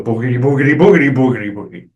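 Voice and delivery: mock bogeyman chant, trailing off at the end; imitating spooky soldiers. Soldiers in ghoul masks spooking villagers, mock spooky chant. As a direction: lightly play-act spooking someone. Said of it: "like the other soldiers and go".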